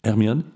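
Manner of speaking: Hermione said the French way, silent h; French pronunciation. Shown as a demonstration. Professor checks French pronunciation of name. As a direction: Hermione as ɛrmən, questioning rise. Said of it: "is that how you pronounce it".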